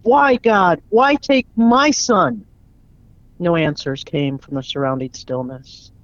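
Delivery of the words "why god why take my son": loud, high anguished cry, slowing down; furious scream. Acted. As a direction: scream it out at God in rage and grief. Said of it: "He screamed with a sudden fury".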